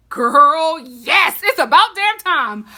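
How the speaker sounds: loud, thrilled exclamation; excited cheer. Real, not acted. Fan cheering the long-awaited couple, loudly excited. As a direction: finally, let it burst out. Celebrating the couple finally together.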